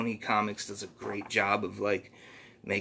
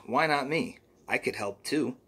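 plain reading voice

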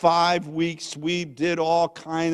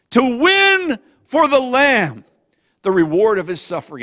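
rallying cry; 8 semitones higher, 9 dB louder than usual. Retold or imitated. Son repeating father's old motto, proclaimed loudly. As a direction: declaim it boldly, stretching the first words.

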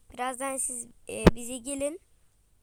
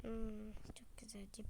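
unvoiced whisper while cooking; 4 semitones lower, 16 dB quieter than usual. Mother whispering over the stove. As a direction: breathe the words to yourself.

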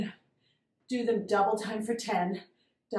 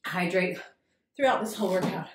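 ordinary talk

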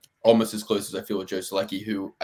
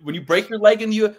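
attempted Long Island accent; 8 semitones higher, 7 dB louder than usual. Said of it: "It's like".

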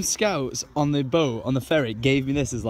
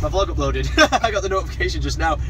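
usual speaking voice

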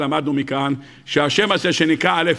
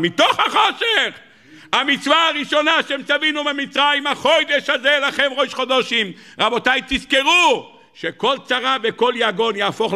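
impassioned preaching; 10 semitones higher than usual. Acted. Preacher telling his congregation God promises salvation, impassioned. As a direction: preach it high and intense, stretching the key words.

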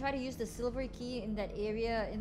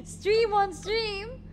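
laughing; 12 semitones higher, 8 dB louder, much slower than usual. Real, not acted. Laughs at own joke.

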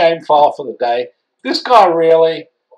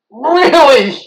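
incredulous; 12 semitones higher, 6 dB louder than usual. Real, not acted. Reacting to insult.

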